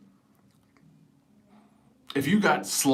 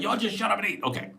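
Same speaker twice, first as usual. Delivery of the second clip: lightly voiced parent, higher pitch; quick higher-pitched parental scolding; exasperated parent; 7 semitones higher, 3 dB quieter than usual. Parent voiced to noisy kids.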